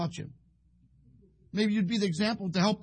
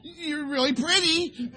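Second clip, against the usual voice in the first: high, slow, tentative compliment; hammed-up unsure flattery; feigned admiration; 6 semitones higher, 3 dB louder, slower than usual. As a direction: play a husband guessing his way out of trouble. Stumped husband offers a lame compliment.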